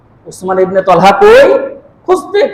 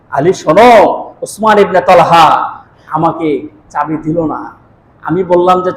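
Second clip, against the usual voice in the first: normal talking voice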